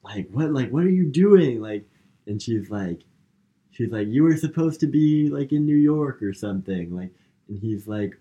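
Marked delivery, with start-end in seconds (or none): 0.3-1.6 high, loud retold indignation; mock confrontation. Acted confrontation in movie retelling. 4.1-6.9 raised-pitch mock accusation; retold accusation. Acted accusation in movie retelling.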